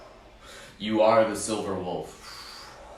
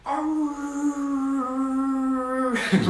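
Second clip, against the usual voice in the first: long high sustained howl; wolf howl. Shown as a demonstration. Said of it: "hard to do a wolf howl".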